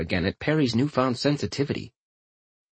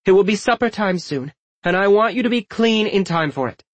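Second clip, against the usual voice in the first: higher, no-nonsense motherly instruction; firm voice; 8 semitones higher than usual. A narrator's reading. Mother telling children to wash for supper, firm. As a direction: lay down the rule, pitched up. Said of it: "Emily said in a firm voice".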